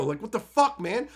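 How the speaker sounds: normal talking voice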